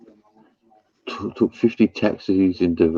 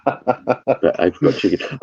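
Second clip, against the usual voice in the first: laughing; 7 semitones higher, slower than usual. Real, not acted. Laughing over a joke.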